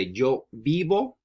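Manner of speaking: Yo vivo con mi hermano drawn out word by word; slow, deliberate teacher modeling; slow model sentence. Shown as a demonstration. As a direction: spell it out slowly for a learner. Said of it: "So you can say".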